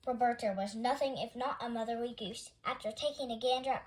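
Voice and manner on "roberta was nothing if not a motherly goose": ordinary delivery, nothing marked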